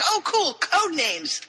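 high, drawn-out delighted quote; mock excitement. Harry hearing about code names, imitated excitement. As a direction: imitate someone thrilled by a new game.

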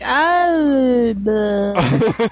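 slow, dragging robot-like voice; slowed down deep. Retold or imitated. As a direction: drag it out low like dying batteries. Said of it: "your voice is slowing down like this".

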